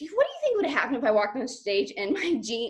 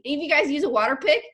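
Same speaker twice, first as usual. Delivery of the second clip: ordinary delivery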